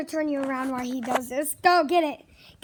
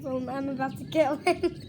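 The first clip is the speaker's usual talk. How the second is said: usual speaking voice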